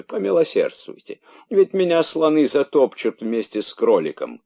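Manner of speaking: usual speaking voice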